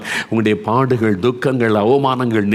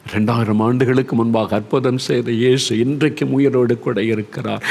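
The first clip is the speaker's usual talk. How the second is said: normal talking voice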